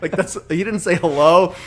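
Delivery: normal talking voice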